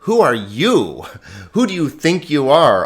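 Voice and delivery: WHO punched with scorn; emphatic who. Shown as a demonstration. Teacher showing the emphatic su. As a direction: hit WHO as if to say who do you think you are. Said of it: "this su here is unnecessary and emphatic".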